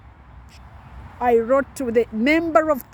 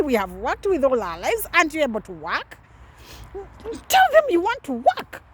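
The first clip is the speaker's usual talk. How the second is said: much higher scolding grandmother voice; 6 semitones higher than usual. Retold or imitated. Grandmother scolding her granddaughter over public funds, high scolding voice. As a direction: voice the proud grandmother telling her off. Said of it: "And she would say".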